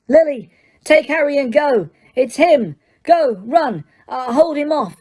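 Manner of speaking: high, frantic orders; panicked shout. Acted. Father shouting at Lily to flee, panicked. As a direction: shout to your wife to run. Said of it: "shouting, panicking".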